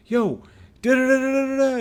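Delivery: high, loud nonsense stand-in for cursing; mock swearing tirade. Promoter swears at his videographer for no reason, mock ranting. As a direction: rattle off an angry imitation of someone cussing. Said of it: "He's just swearing at me".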